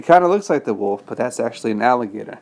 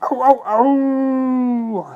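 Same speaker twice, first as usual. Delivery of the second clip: high, loud yelps rising; wolf screaming in the boiling pot; 8 semitones higher, 4 dB louder, much slower than usual. Acted. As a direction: yelp in pain like a burnt wolf. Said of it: "screamed the wolf".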